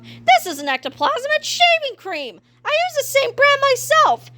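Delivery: high, blustery principal; Mister Krupp explaining to Kipper, blustery; gruff principal. A narrator's reading. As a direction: lecture it like a know-it-all. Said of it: "Mister Krupp explaining".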